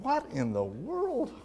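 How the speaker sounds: "What in the world?" said as a bewildered exclamation; incredulous. Retold or imitated. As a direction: ask "What in the world?" in amazed disbelief. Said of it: "And I'm thinking".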